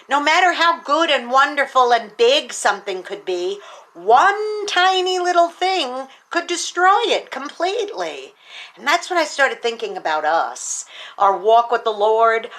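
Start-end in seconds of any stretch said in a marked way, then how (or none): 3.9-8.3 high, slow stress on destroy; preacher hammering the point home; emphatic key line. Real, not acted. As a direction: lean hard on destroy. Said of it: "sermon key point emphasis".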